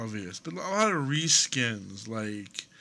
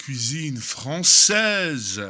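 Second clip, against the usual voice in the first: French words said slowly and louder; French accent; 3 semitones higher, 8 dB louder, slower than usual. Real, not acted. Reviewer naming French food in a French accent. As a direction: give it a full, drawn-out French pronunciation. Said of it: "My accent in that sounds completely different in French".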